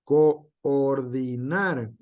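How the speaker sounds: Coordinar said very slowly, co and or apart; slow split. Shown as a demonstration. Teacher showing the separation to students, slowly. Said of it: "tenemos de nuevo esa separación".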